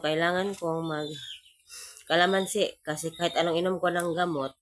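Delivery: ordinary talk, nothing marked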